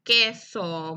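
"Queso" whole, without a break; syllables joined. Shown as a demonstration. Teacher repeats the combined syllables, "Queso" in one piece. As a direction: say "Queso" as one blended word. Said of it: "Y juntos dicen".

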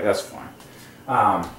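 ordinary talk, nothing marked

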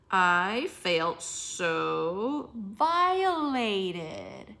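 drawn out, rising at the end; slow dramatic reading. Shown as a demonstration. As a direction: stretch each word slowly. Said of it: "reading her own message aloud".

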